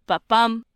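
"ba, bum" landing loud on BUM; light "ba", strong "bum"; unstressed then stressed. Shown as a demonstration. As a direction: iamb beat, weak-strong on "ba, bum". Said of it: "unstressed followed by a stressed".

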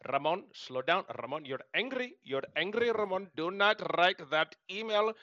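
imitating a thick Indian accent, mentor's gentle warning. Mentor warning Ramon off an angry email, Indian accent. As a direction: mimic an older Indian mentor's accent, pleading. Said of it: "in his thick accent, which I'm gonna mimic".

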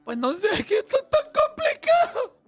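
hammed-up high, breaking sob-voice; exaggerated weepy falsetto, voice climbing into a wail; mock tearful wail. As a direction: whine it way up high like a crybaby athlete. Mimics tearful player answering interviewer.

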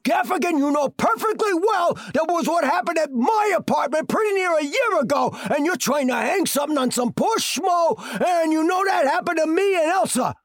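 high, loud courtroom outburst; shouting down the lying cop; furious shout. Acted. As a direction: yell the accusation across the court. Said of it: "I stood up and I said".

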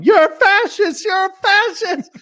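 high, loud accusatory yell. Retold or imitated. Players yelling accusations in Secret Hitler, high-pitched yelling. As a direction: shriek it like an accusation. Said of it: "people playing Secret Hitler yelling".

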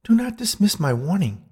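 high, admonishing ghost woman; stern warning from a high-voiced spirit. A narrator's reading. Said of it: "she admonished".

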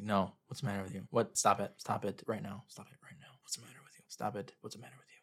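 very soft, toneless repeated scolding; whispered monotone. Retold or imitated. Santa to a crying, squirming child, monotone whisper. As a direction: murmur each order, sinking into a whisper. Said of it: "he's really monotone".

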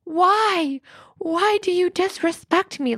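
high, strained, tearful pleading, mock crying; replaying her own meltdown over the hoodie; retold tearful outburst. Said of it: "I started crying".

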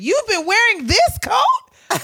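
very high, loud, drawn-out incredulity; hammed-up appalled daughter; shocked disbelief. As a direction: stretch it out in outraged disbelief. Retold shocked reaction to mother.

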